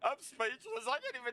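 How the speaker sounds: high-pitched, helpless from laughing; real, barely able to talk for laughing; too amused to talk. Real, not acted. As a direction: squeeze words out after a laughing fit. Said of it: "talk right now".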